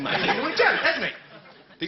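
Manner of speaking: normal talking voice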